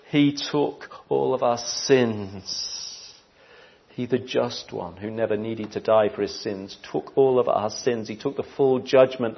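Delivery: quiet reverent sermon voice; solemn hush. Acted. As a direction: lean in and speak it gently.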